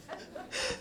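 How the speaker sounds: very high, breathy quick laugh; squeal of laughter. Real, not acted. After quoting the healed twin, squealing laugh. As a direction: burst out laughing.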